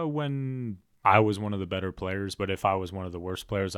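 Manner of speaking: normal talking voice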